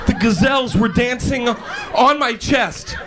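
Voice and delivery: high-pitched, hammed-up victim's account; mock-distraught excuse. Late employee claiming circus animals held him, hammed-up distress. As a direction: play the traumatised hostage, slowing towards the end. Inventing absurd excuse for boss.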